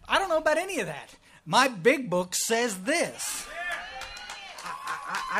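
put-on drawn out like a stubborn old-timer digging in; mocking closed-minded member. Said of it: "mocks closed-minded members".

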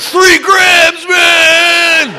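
loud, high put-on voice of a wired guy; the addict admitting to the cocaine, loudly; stoner shout. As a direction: brag it out like a high man. Andy admits drug use.

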